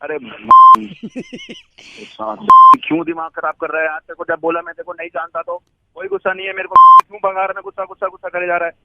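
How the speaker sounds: annoyed, rising outburst; snapping back in irritation; escalating comedy rant. Real, not acted. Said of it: "देखा, आ गया ना?".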